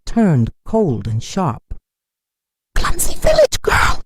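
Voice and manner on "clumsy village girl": very high, louder shout; aunt yells at the girl in front of guests. A narrator's reading. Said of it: "she shouted".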